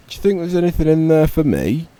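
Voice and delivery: high, loud, fast and hopeful; eager frog. Acted. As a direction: blurt it out in a rush of hope. Eager frog asks robin.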